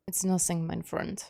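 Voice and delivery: put-on lightly done German accent; quiet, imperfect German accent; attempted German accent. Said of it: "I'm trying to do a German accent".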